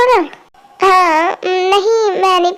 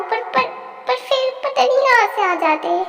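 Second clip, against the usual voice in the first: hushed, annoyed grumble, a bit higher and softer; muttered complaint; 4 semitones higher, 7 dB quieter than usual. Acted. Friend grumbles about them showing up, a soft annoyed mutter. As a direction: complain under your breath.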